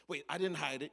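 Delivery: flustered denial. Acted. Denying to boss.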